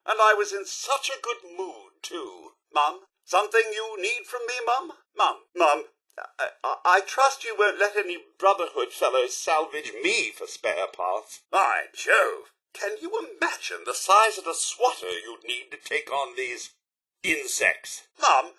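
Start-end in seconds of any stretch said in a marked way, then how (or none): none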